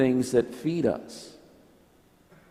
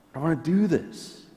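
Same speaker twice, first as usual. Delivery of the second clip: a mild anxious protest, higher and fast; fearful resistance; 6 semitones higher, faster than usual. Retold or imitated. People afraid of change, a mild fast anxious protest. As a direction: push back nervously against change. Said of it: "that demon voice".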